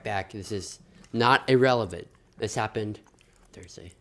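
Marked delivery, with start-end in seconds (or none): 3.5-3.9 soft whisper trailing off. Real, not acted. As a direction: drop to a whisper. Hushed aside listing days.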